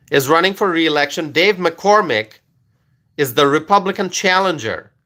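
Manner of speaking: ordinary talk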